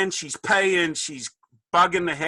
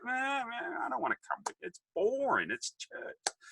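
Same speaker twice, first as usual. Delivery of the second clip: whiny refusal; 11 semitones higher, 10 dB quieter than usual. Retold or imitated.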